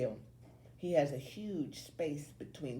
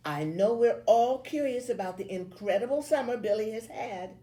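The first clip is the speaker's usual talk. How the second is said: raised, projecting teacher voice; gushing teacher; 7 semitones higher, 7 dB louder than usual. A narrator's reading. Teacher introducing Billy's summer to classmates, gushing. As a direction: build up the pupil before the class.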